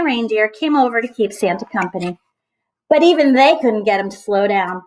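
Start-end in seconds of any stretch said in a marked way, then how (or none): none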